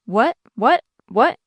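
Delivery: stunned, rising one-word questions; amazed. A narrator's reading. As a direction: blurt each word in disbelief, lifting at the end. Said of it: "nearly dumb with amazement".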